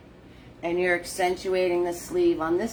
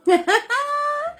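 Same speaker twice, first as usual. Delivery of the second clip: a squealing high laugh; 4 dB louder than usual. Real, not acted. Host laughing at her own repeated paint tip, squealing laugh. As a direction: burst out laughing.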